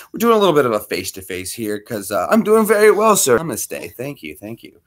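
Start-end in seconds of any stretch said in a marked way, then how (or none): none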